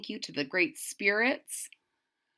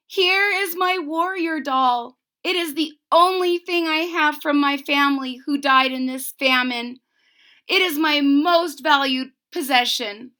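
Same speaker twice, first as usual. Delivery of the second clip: higher, earnest plea to the spirits; pleading offering; 7 semitones higher, 9 dB louder than usual. Acted. Girl offers her doll to the spirits, pleading. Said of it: "she who is alone said".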